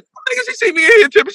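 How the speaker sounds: high-pitched, fretting retold panic; mock panic. Son afraid mom sees him tripping, high mock panic. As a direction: squeak it out in comic worry.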